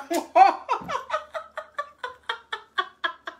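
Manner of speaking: long high-pitched giggling fit; laughing at the players' nicknames, high cackling giggle; high helpless laugh. Real, not acted. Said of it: "j'en fous rire".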